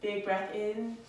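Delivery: slow, soothing instructor cues timed to the breath; guided yoga voice. Acted. Yoga teacher guiding students through poses, soothing. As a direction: talk the class through each breath calmly.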